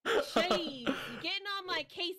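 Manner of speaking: brash, loud Brooklyn complaining; loud Brooklyn accent. Acted. Fed-up Brooklyn cheese seller griping at a customer, loud Brooklyn accent. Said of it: "[name] had a really good frickin' Brooklyn accent just now".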